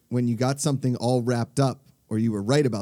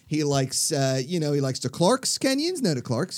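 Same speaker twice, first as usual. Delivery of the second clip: higher-pitched, gushing Pittsburgh local; Pittsburgh accent; 5 semitones higher than usual. Acted. Tailgater praising a fellow Yinzer, hammed-up Pittsburgh accent. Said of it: "No, they're like".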